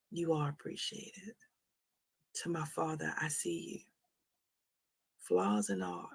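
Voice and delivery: very soft, heartfelt, with pauses; tender sincerity. Real, not acted. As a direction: thank a father softly and earnestly. Heartfelt thanks to her father.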